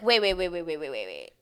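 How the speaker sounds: a fast burst of waits that drops away; rapid protest. Retold or imitated. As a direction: cut him off in disbelief. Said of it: "I was like".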